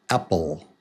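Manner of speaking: ordinary talk, nothing marked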